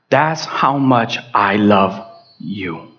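very low, slow, hushed assurance; tender declaration. Retold or imitated. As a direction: let each word settle tenderly as God's. God's love voiced tenderly.

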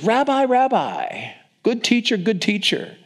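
hammed-up eager greeting; effusive greeting. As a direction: fawn over the teacher in a high voice. Judas's effusive greeting acted out.

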